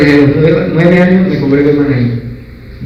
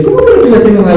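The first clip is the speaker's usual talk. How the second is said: higher, louder outraged question; indignant retort; 6 semitones higher, 5 dB louder, faster than usual. Retold or imitated. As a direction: throw the accusation right back at them. Indignant reply to the accusation. (réplica indignada, más alta y fuerte)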